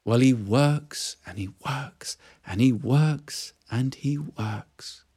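whispered, awed narration; hushed whisper. A narrator's reading. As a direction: breathe it out in awe.

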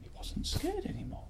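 breathy hushed whisper. A narrator's reading. Storyteller whispering to a listening child.

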